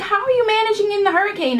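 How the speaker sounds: high, fast mock-concerned question; quick high-voiced client impersonation; imitated clients. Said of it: "And they're like".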